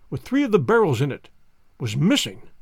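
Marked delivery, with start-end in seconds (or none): none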